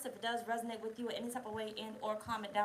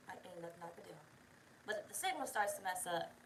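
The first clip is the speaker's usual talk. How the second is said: ordinary delivery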